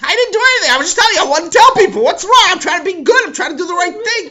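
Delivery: high loud exchange; loud argument. Acted. Yossi and Sammy arguing, loud. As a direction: argue it out loud.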